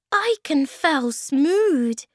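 high, slow little creature voice; small creature, words drawn out high; tiny creature. A narrator's reading. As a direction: squeak it slowly and loudly. Tiny creature Thingamy speaks.

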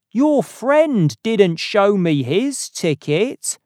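loud, high, slow call after someone; shouted call. A narrator's reading. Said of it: "the ticket man shouted after Carswell".

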